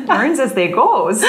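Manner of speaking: slow laughing Midwest accent. Shown as a demonstration. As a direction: lay the Midwest accent on thick. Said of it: "I like saying tapioca in that accent".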